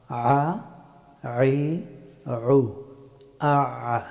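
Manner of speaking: "আ, আই, উ" squeezed from mid-throat; Arabic ayn. Shown as a demonstration. Teacher drilling the difficult ayn, mid-throat sound. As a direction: constrict the throat on each vowel of "আ, আই, উ". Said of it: "গলার মধ্যখান থেকে".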